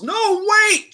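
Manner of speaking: very high, loud desperate cry; armored fighter yelling to stop the throw; panicked yell. Acted. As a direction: yell it too late to stop him. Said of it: "turns and yells".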